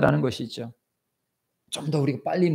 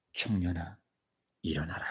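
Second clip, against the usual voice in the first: low quiet call; 10 semitones lower, 8 dB quieter than usual. Acted. Jesus at the coffin.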